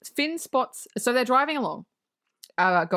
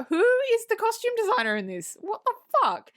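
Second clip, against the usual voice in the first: high, fast disbelieving squeal; mock outrage at the costumes; shrill-free high incredulous what the fuck; incredulous outburst; 11 semitones higher than usual. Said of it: "I'm like".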